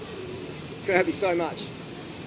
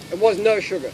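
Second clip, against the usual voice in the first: usual speaking voice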